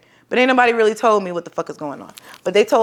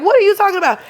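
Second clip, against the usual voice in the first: high, loud protesting question; frantic shout; 11 semitones higher, 4 dB louder, faster than usual. Retold or imitated. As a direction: shout back in disbelief. Arguing with running nurse.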